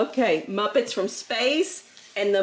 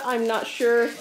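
ordinary talk

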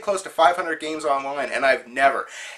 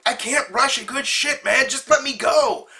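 higher, strained, pleading yell; mocking the toilet guy; 10 semitones higher than usual. Opponent on the toilet begging his teammate, mock high-pitched yell. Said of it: "is like".

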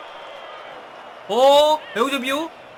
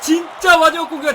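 drawn-out loud cheer; excited yell; 6 semitones higher, 7 dB louder, much slower than usual. Real, not acted. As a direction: cheer the last attack. Last attack, yelling. (마지막 공격에 흥분한 외침)

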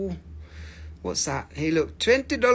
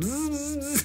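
high buzzing like a bee; comic bee noise; 10 semitones higher, 6 dB quieter than usual. Shown as a demonstration. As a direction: imitate a bee with a sustained buzz. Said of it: "I'm just gonna buzz like a bee".

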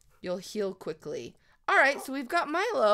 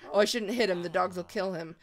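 ordinary delivery

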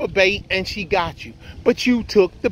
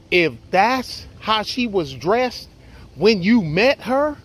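ordinary delivery

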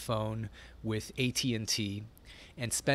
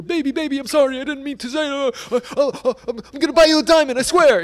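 hammed-up begging boyfriend, very high and loud; mock frantic pleading; 13 dB louder, faster than usual. Boyfriend runs after crying girlfriend, begging frantically. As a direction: imitate a desperate apology at full volume. He'd run after her.

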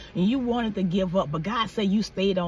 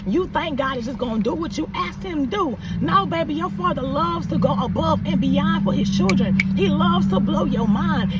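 loud, higher, rousing sermon climax; preaching at full force; 4 semitones higher, 3 dB louder than usual. Acted. Preacher pumping up viewers about blessings, loud and fervent. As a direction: drive it home like a Sunday peak. Preaching peak, shouted encouragement.

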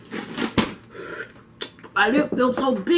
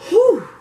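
high loud whew blowing out heat; hot exhale; 13 semitones higher, 8 dB louder than usual. Real, not acted. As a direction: blow out the burning heat. Eating very spicy food.

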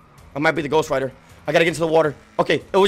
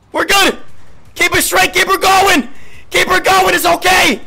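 high, loud urging; frantic self-encouragement; 9 semitones higher, 4 dB louder than usual. Real, not acted. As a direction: shout yourself through a near crash. Urging self on while driving.

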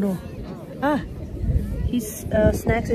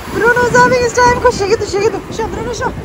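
high, louder cooing at the dog; excited dog talk; 11 semitones higher, 4 dB louder than usual. Real, not acted. Owner playing with dog Bruno at the beach, high excited voice. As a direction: hype the dog up to shake.

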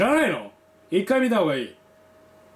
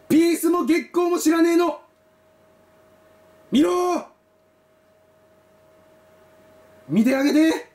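shouting; 7 semitones higher than usual. Real, not acted. Urging viewers to watch.

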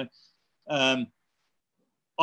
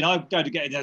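ordinary talk

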